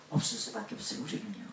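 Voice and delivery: preacher confessing to congregation in a hush; hushed confession. Real, not acted.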